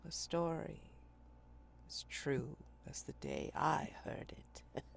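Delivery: slow, hushed storyteller sailor; haunted old sailor. Acted. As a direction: draw them into an old sea tale. GM voices old lieutenant telling tale.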